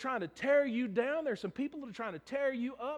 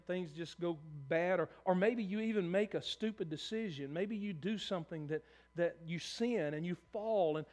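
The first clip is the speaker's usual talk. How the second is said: usual speaking voice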